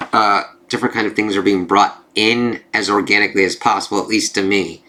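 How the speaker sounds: ordinary talk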